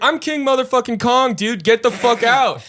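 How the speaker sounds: triumphant mock yell at a rude customer; defiant retort shout. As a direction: throw him out with a cocky roar. Bartender retorts, kicking him out.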